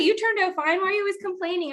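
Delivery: a playful, high imitation of mom; mom's voice. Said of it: "'cause my mom's like".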